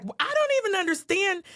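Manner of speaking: high, exasperated disbelief; bewildered. Retold or imitated. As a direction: play it baffled and high. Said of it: "she was like".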